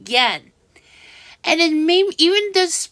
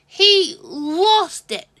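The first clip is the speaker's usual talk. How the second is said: ordinary talk, nothing marked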